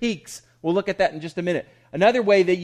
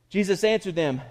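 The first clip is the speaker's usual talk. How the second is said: ordinary talk, nothing marked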